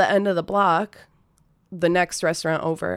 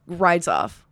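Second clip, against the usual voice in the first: normal talking voice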